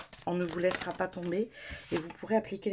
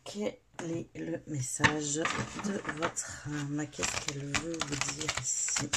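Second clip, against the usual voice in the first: slow incantation; 2 semitones lower, slower than usual. Acted. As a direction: ask it like a ritual chant. Slow incantatory questions over cards. (incantation lente et douce)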